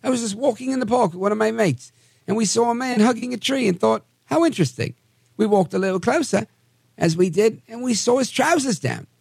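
high-pitched mock British accent; British eyewitness impression, high and lilting. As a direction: put on a hammed-up British voice, pitched well up. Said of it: "I have to do it in my British accent".

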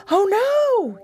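high startled yelp. Acted. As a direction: yelp it out in alarm. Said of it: "he yelped".

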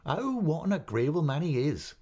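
high, eager exclamation in another voice; delighted cry. Acted. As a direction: burst out with delight for her brother. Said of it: "cried Peggotty".

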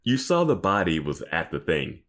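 ordinary talk, nothing marked

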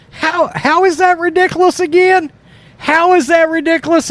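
a high, squeaky mock of the reporter; mocking imitation. Host mimics the reporter asking how it's ridiculous, mocking. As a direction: ham up a whiny questioner. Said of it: "He's like".

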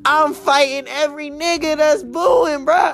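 very high furious yell; angry shout. Real, not acted. Vlogger imagining fighting the booers, furious shout. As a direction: shout it like you're ready to fight.

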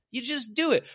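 high, loud impression of a motivator; mock motivational shout. Speaker answering a house seller, mock motivational shout. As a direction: shout the answer like a hammed-up coach.

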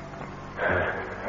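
long weary sigh of defeat; resigned sigh. Acted. Steve gives in to the blackmailer with a weary sigh. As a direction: breathe out, beaten and resigned.

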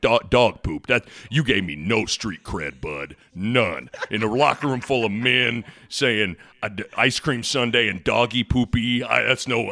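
low, mock-scolding coach voice; Coach Self impression. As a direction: tease him low like the coach. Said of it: "He was like".

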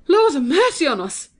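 as if seeing a ghost; old woman's frightened outcry; shocked exclamation. A narrator's reading. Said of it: "going cold all over".

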